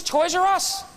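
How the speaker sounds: put-on eager little boy. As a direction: sound like a five-year-old who can't wait.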